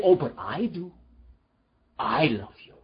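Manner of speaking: soft and low, quietly certain; tender reassurance. Acted. God answering someone who pushed him away, soft and tender. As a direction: answer the doubt gently.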